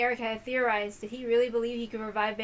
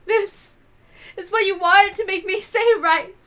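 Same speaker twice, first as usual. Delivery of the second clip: higher, louder, slow tearful words; sobbing; 7 semitones higher, 8 dB louder, slower than usual. Acted. Natsuhi tearfully accuses the hidden culprit. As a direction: choke the words out through tears. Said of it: "Aunt Natsuhi sobbed as she spoke".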